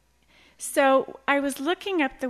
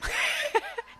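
embarrassed laugh. Real, not acted. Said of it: "Did I say that with confidence?".

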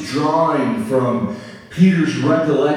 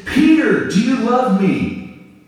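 high, louder call of Peter's name; Jesus pressing; 9 semitones higher, 3 dB louder, slower than usual. Acted. Jesus confronting Peter, high earnest call. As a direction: press him kindly. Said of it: "It's like this".

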